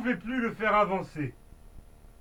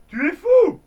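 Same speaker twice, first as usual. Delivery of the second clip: hurle, beaucoup plus aigu et fort; hurlement; 9 semitones higher, 10 dB louder than usual. Acted. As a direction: crie d'effroi au tireur. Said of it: "Le garde hurla". (le garde hurle contre Billy)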